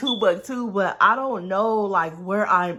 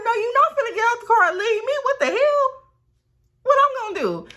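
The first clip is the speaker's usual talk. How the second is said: high, loud, rushed objection; alarmed protest; 5 dB louder, faster than usual. Retold or imitated. Storyteller replays protesting to her fleeing friend, alarmed protest.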